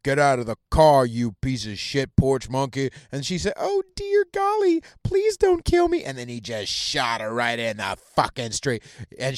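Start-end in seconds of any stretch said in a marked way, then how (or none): 0.0-2.9 lightly voiced evil cop, lower; mock menacing cop in a lower voice. 3.6-6.0 very high, slow pleading falsetto; mock innocent victim. Mocking retold innocent victim pleading.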